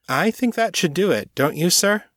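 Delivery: spring the trap with a knowing lift; smug reveal. Acted. Detective revealing hidden officers to his captain, smug.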